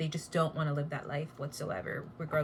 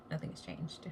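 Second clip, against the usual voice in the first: soft unvoiced whisper repeat; 7 dB quieter than usual. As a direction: breathe the line out quietly.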